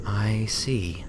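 whispered, calmed down; mollified. A narrator's reading. Twilight, placated by Materi's answer, whispers quietly. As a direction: breathe it out, placated.

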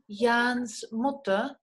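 Jans Mutter spelled out very slowly, each sound drawn out; slow demo. Shown as a demonstration. Teacher slowly models the possessive for the class. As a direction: stretch out Jans Mutter so students catch the s. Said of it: "Podemos decir en alemán".

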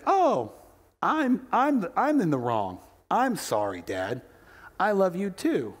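hammed-up apologetic kid, swooping high; mock contrition. Son imagined apologizing to dad, exaggerated contrite voice. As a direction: overplay the sheepish apology.

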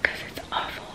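whispered, heavy with feeling; sorrowful whisper. Real, not acted. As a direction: drop to a bare whisper. Whispered aside after apology.

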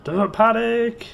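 high, loud comic cry of alarm; hammed-up sitcom panic, stretched out; Dad's Army impression. Quoting Dad's Army to viewers, comic panicky cry. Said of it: "but Dad's Army".

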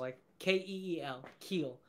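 Keel said like K E E L; spelling out keel. Shown as a demonstration. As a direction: Keel rhymes with feel. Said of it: "I just say keel".

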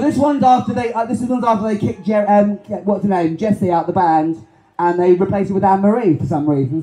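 ordinary talk, nothing marked

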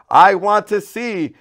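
loud, high, slow plea; crying out. A narrator's reading. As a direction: declare it with all you have. Blind man answering Jesus.

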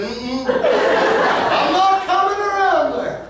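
high, slow, laughing refusal; teasing wife refusal. Retold or imitated. Wife playfully refusing her husband in the store, high and laughing. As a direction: play the wife giggling no. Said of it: "And she said".

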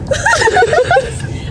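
a short burst of very high laughter. Real, not acted. Laughing while enjoying the view.

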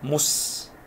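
مس held on a long s, "muss"; closed syllable. Shown as a demonstration. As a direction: end مس on a vowelless s. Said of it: "هنا مقطع ساكن".

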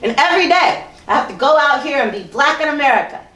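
forceful, declamatory poetry performance; spoken-word poem. Acted. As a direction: deliver it as a pointed spoken-word piece. Recites a second poem.

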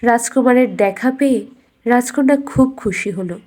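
usual speaking voice

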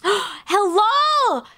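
thrilled outburst; excited shout. Real, not acted. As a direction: yell it out in delight. Sees three women customers.

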